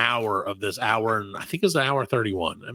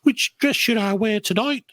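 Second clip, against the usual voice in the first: mock posh British accent; 8 semitones higher, slower than usual. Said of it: "the proper British".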